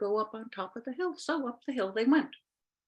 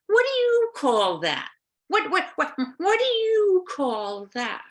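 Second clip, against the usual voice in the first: high slow curious witch voice; 9 semitones higher, 4 dB louder than usual. Acted. Witch asks about the sunset.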